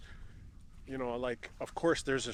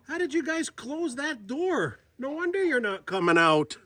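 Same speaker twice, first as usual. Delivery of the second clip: talking to geese; 9 semitones higher, 4 dB louder than usual. Real, not acted. Fussing at locked-in geese.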